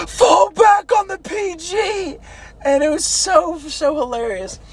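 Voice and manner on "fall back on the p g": high, drawn-out battle cry; dramatic mock yell. Funny recruit yelling an order during the mock attack, hammed-up yell. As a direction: holler a hammed-up war-movie order, slowly. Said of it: "he yells".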